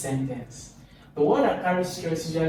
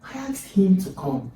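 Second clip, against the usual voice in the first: "him" picked out, higher, then falling on "to come"; stress on him; 5 semitones higher than usual. Shown as a demonstration. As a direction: stress "him" and drop away after it. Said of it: "still a stress on him".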